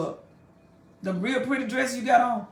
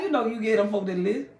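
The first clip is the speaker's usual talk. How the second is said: ordinary delivery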